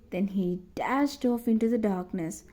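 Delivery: usual speaking voice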